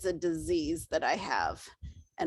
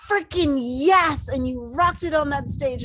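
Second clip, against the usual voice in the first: exuberant cheer; 7 semitones higher, 5 dB louder than usual. Real, not acted. Leader proud of teammate on stage, exuberant. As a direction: celebrate them at full voice.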